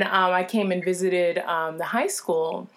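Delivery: ordinary delivery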